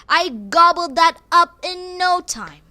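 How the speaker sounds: slow, playful relish; mock gleeful. Telling listeners about eating cookies, gleeful. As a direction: savor each word like the cookie.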